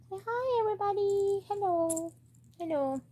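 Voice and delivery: very high singsong greeting; pet-talk coo. Real, not acted. Owner has the pet greet everybody, high cooing baby talk.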